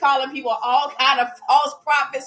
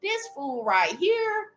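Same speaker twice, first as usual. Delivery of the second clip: slow, higher, dismissive; demons unimpressed; 6 semitones higher, 3 dB quieter, slower than usual. Retold or imitated. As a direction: drawl it out with scorn. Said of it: "them demons just like".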